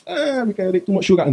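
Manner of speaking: mocking reply. Mock dismissive reply, laughing.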